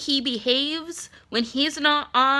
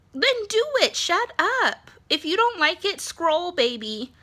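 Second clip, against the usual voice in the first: pitched up high, sassy comeback; sassy retort; 7 semitones higher than usual. Retold or imitated. Snapping at a critic online with a high sassy retort. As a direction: fire back with a high sassy brush-off.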